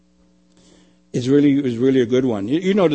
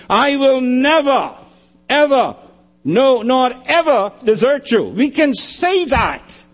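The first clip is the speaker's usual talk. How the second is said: fervent sermon peak; impassioned preaching; 8 semitones higher than usual. Real, not acted. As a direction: thunder the promise. Preacher at full pitch.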